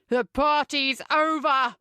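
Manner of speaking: hammered-out slow shout. A narrator's reading. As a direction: slam the door on the party. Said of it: "he shouts".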